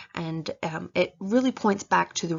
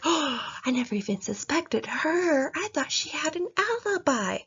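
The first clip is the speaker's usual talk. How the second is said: shocked reader; 8 semitones higher than usual. Retold or imitated. Reader stunned by the mystery twist, voice shooting high in shock. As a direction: gasp it out like the twist just floored you. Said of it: "And you're like".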